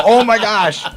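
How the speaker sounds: high, loud gasp of astonishment; imitated starstruck outburst; amazed onlookers. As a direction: exclaim like a crowd suddenly stunned. Acting out stunned onlookers' reaction.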